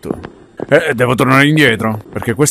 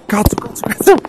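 high panicked swearing; explosive italian cursing; 9 semitones higher than usual. Real, not acted. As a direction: spit out the curses in panic.

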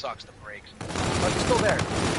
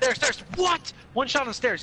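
panicked callout; 11 semitones higher than usual. Real, not acted. Urgent callout during firefight.

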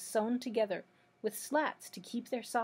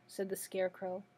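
usual speaking voice

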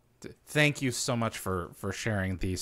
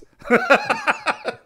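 big high laugh; 11 dB louder than usual. Real, not acted. Host laughs at catchphrase.

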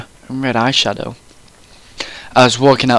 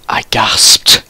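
breathy whispered gasp; 3 semitones lower than usual. Acted. Ebony stunned by Draco's invitation, gasping.

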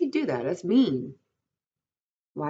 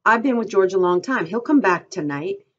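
loud, insistent protest; higher, forceful reassurance; crying out; 4 semitones higher, 8 dB louder than usual. A narrator's reading. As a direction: insist it loudly, voice raised. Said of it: "Lenny cried".

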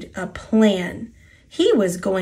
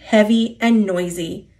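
usual speaking voice

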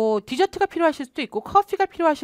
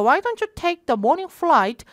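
slow model read; 3 semitones higher, much slower than usual. Shown as a demonstration. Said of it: "라고 제안하는 거".

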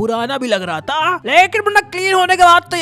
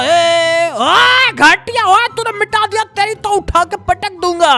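high, loud mock-angry threat; playful outrage; 7 semitones higher, 5 dB louder, slower than usual. Threatening his friend for erasing the drawn face, outraged. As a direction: yell it higher, in playful outrage.

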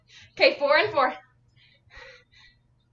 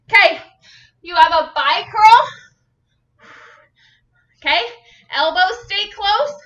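ordinary talk

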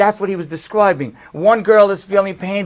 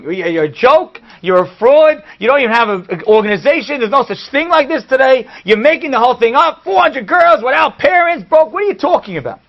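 loud, high angry tirade; high-pitched, indignant shouting; yelling; 8 semitones higher, 7 dB louder than usual. Retold or imitated. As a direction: yell it as an outraged accusation. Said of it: "He starts yelling at me".

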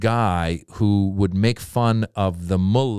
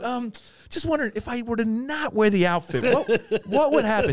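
high-pitched hesitant plea; timid asking; 12 semitones higher than usual. Retold or imitated. Comedian asks booker about skipping outfit, high and sheepish. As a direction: tiptoe into the awkward question.

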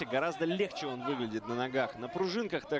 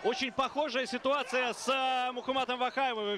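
excited commentary; 11 semitones higher than usual. Real, not acted. Excited comparison mid-fight.

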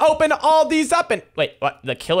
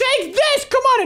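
battle cry; slower than usual. Real, not acted. Attacking an opponent in game.

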